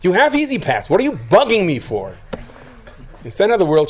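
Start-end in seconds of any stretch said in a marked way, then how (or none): 0.0-2.2 higher, irritated brush-off; annoyed toll attendant. Retold or imitated. Toll booth man on his phone dismissing the driver, irritated. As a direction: snap back like a bothered clerk. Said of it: "and he goes".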